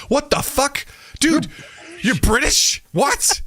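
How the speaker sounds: high-pitched, incredulous outburst; astonished. Retold or imitated. Fan learning an actor is British, high-pitched astonishment. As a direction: squeak it out in mock disbelief. Acts out shock at actor's accent.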